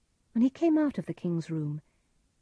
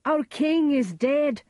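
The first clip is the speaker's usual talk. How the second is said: high, loud, slow announcement of disaster; anguished cry; 8 semitones higher, 6 dB louder, slower than usual. A narrator's reading. Macduff tells Banquo the king is dead, anguished cry. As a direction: cry out the terrible news. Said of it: "cried Macduff".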